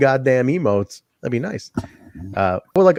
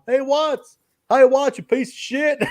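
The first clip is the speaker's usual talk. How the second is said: high mock heckler voice; mock heckler jeering the wrestler; heckling fan; 12 semitones higher, slower than usual. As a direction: yell at Watts like a fan in the crowd. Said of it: "the one fan you hear going".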